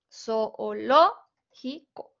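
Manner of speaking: "Zoológico" drawn out, each o its own syllable; syllables split apart. Shown as a demonstration. Teacher showing the class a hiatus, syllable by syllable. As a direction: say "Zoológico" slowly, breaking zo-o-ló-gi-co apart. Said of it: "las tengo que separar".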